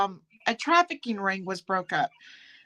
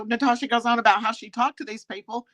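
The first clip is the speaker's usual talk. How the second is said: ordinary talk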